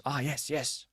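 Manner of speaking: lightly voiced old man, soft; a hint of a frail old man; elderly voice. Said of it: "Elderly voice".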